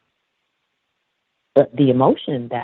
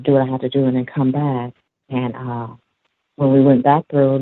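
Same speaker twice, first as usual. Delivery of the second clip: ordinary delivery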